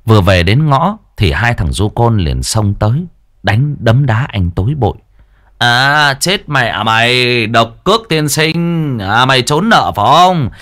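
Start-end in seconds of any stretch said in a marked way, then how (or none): 5.6-10.5 menacing thugs. Acted. Thugs to the debtor Hoàng, menacing. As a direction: taunt him while beating him.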